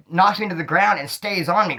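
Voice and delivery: ordinary delivery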